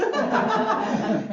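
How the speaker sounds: loud high burst of laughter; big high laugh. Real, not acted. Speaker laughing at her screaming story, loud laugh. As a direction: laugh hard at yourself.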